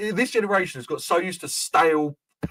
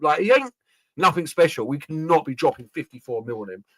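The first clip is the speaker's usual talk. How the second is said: ordinary delivery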